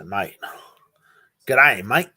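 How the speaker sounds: playful Australian greeting, rising at the end; Aussie slang. Shown as a demonstration. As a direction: throw out a cheery Australian "G'day, mate". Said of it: "Say in an Aussie slang".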